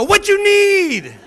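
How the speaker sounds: put-on high, loud, slow Indian accent, teasing; mock rude attendant. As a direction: answer the kids in the attendant's gruff voice. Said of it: "and I'd go".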